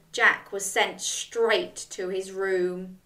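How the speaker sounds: ordinary delivery, nothing marked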